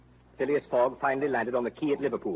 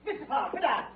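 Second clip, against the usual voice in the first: high, urgent cries across the room; frantic calling out; 11 semitones higher, 4 dB quieter than usual. Acted. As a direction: call him back before it's too late. Detective runs in calling out.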